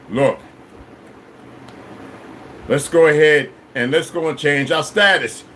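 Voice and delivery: usual speaking voice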